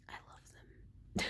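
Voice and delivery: slow, breathy whispered confession through a laugh; slow, hushed adoring whisper. Real, not acted. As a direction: whisper it lovingly with a little laugh. Gushing over beloved characters.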